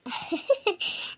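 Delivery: soft amused chuckle; laughing. Acted. Woman hears the tearful complaint about gossips, soft laugh. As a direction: laugh softly at the other's distress. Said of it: "ನಗ್ಲಾಕತ್ತೀಯಲ್ಲ ಯವ್ವ".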